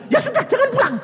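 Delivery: squeaky resigned sister; high mock sister voice. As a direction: sister grudgingly giving in, high voice.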